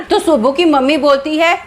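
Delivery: plain reading voice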